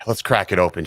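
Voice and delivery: ordinary talk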